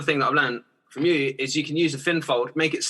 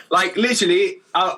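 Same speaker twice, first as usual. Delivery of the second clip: usual speaking voice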